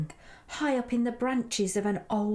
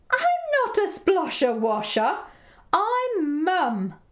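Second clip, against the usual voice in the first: louder, high-pitched mock outrage; indignant; 9 semitones higher, 6 dB louder than usual. Mum corrects Edward's name for her, indignantly. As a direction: protest as an affronted storybook mum.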